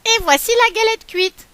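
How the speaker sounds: put-on old woman proudly serving the hot galette, loud; proud announcement. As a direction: present the cake with glee.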